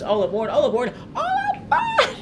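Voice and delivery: sung way up high, soaring on the last aboard; belted mock high note on "all aboard"; imitating the high notes. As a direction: mimic the diva's crazy high note, half laughing. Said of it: "crazy high notes".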